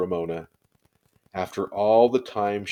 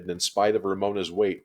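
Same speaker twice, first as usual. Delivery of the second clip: plain reading voice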